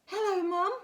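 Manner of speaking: high, loud hello; excited greeting. A narrator's reading. Spinderella greets Mum at the web, high excited call. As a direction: call out brightly like a child coming home.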